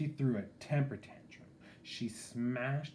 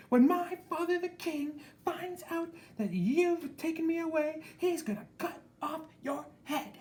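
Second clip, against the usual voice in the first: much higher, louder bossy yell; angry princess shout; 5 dB louder than usual. A narrator's reading. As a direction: scold the dragon shrilly high. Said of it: "she shouted".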